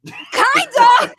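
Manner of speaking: shouted emphatic agreement; loud exclaim. Real, not acted. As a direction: blurt it out loud. Agreeing loudly with host's joke.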